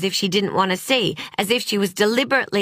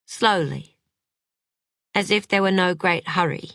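usual speaking voice

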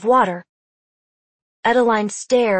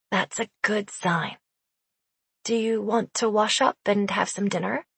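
soft and calm; 6 dB quieter than usual. Acted. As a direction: gently reassure her. Adeline calmly answers Sophie.

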